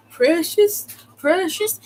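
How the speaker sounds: high, rising mock call of a name; mocking sing-song. Boy in the next cage teasing her name, mocking. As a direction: tease the name like a kid taunting. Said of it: "he was making fun of my name".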